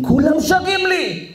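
high, drawn-out proclamation; rousing cry. Acted. God telling how all creation sings to him, rousing cry. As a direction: raise the pitch and stretch it out. God's line repeated with force.

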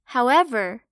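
plain reading voice

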